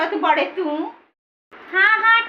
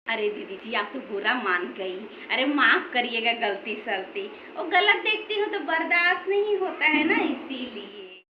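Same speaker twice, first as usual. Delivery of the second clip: meek apology; 7 semitones lower, 6 dB quieter than usual. Acted. Daughter-in-law apologizing meekly.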